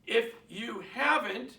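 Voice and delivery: normal talking voice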